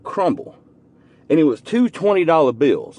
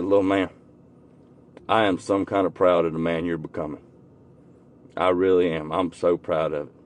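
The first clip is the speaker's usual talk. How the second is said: low, soft, sincere retelling; hushed, tender words of praise; heartfelt pride; 7 semitones lower, 6 dB quieter than usual. Retold or imitated. Telling his nephew he is proud.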